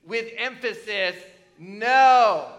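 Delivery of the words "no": a loud, emphatic "no" thrown out to the room; the preacher's emphatic, loud "no". Shown as a demonstration. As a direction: hit "no" with full emphasis, much louder. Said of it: "An emphatic".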